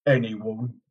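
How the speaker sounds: ominous. A narrator's reading. Lan's apparition repeats sinister warning.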